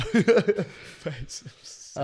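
slow, high, giggling through it; laughing. Real, not acted. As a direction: laugh the words out. Laughing repeat.

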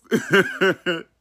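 high bursting laugh; a loud high cackle of laughter. Real, not acted. Laughing burst in birthday message.

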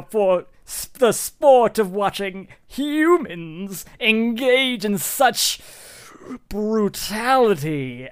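high, slow, scandalized gentleman; Reginald. Acted. Rich spectator watching humans wrestle a lion, theatrically aghast. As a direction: draw the words out in theatrical shock. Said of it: "you hear Reginald go".

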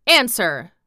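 loud, sharp command; lordly one-word order; imperious order. A narrator's reading. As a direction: demand a reply at once. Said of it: "demanded Colin still more imperiously".

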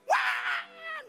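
high, drawn-out mock crying; piercing baby "waaah"; imitated baby wail. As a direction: bawl like an unconsoled baby. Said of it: "nangis terus".

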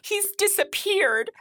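high, weepy character voice; hammed-up tearful wife. Wife telling of her missing husband, weepy and high. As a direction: tearfully break the news, pitched very high.

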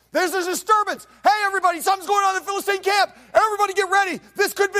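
high, loud call to arms; rally everyone in a panic; urgent alarm. Retold or imitated. Lookouts in the cave calling everyone to get ready, shouting. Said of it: "People up in the cave are like".

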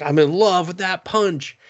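high, infatuated exclamation; hammed-up declaration of love, pitched high; imitating a thrilled admirer; mock smitten. Brother smitten by punch.

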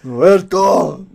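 loud slow drawn-out call; mock scream. Friend joining the story, loud stretched mock call. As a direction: stretch the name out like a shriek.

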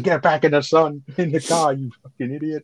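imitating a fed-up mother yelling at her son; mock scolding mother. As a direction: scold him like an exasperated mum, half in jest.

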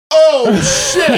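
high laughing gasp of surprise; shocked laugh. Retold or imitated. To the neighbor, shocked by her revelation, laughing. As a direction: burst out laughing at the news.

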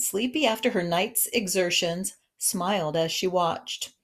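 ordinary delivery, nothing marked

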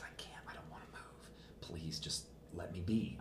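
very faint, slow whispered plea; whispering. Retold or imitated. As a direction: re-enact pleading in a bare whisper. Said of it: "I was whispering literally like that".

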